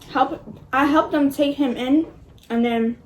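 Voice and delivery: ordinary talk, nothing marked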